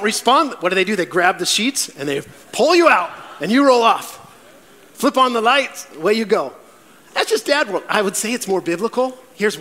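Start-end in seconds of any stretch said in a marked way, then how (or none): none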